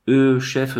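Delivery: a mildly stammering start; flustered stammer. A narrator's reading. Shaken watchman answers his chief, mildly stammering. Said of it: "bredouilla le veilleur".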